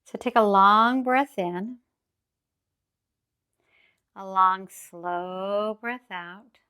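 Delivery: slow, drawn-out breathing cue; calm grounding exercise. Acted. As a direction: stretch the exhale out long.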